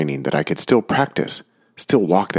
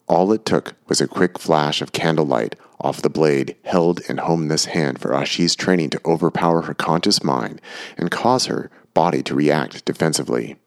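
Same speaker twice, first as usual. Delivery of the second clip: ordinary delivery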